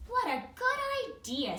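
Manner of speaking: high, loud motherly voice; Missus Blair voice. Acted. Reading to the class as mum bear, high and bright.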